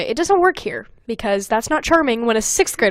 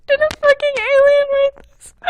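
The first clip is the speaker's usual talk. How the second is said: much higher squeal of horror; high squealed disbelief. Real, not acted. Cringing at own writing.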